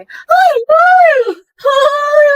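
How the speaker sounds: high, loud squeals of kilig; excited squealing. Acted. Friends cheering on a smitten girl, excited squealing.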